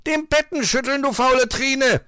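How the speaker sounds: high, loud fox scolding; angry growling. A narrator's reading. Fox orders lazy girl to work, angry. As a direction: yell it as an irate order. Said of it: "knurrte er".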